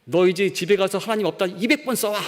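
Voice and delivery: put-on teacher scolding a child who still believes in God, angrily; angry teacher. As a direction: snap the punishment at the child, pitch up. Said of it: "화가 엄청 났죠".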